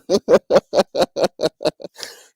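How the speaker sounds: loud cackling laughter; long laugh. Real, not acted. Cracking up after teasing a co-host, long loud laugh. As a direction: crack up at your own boast.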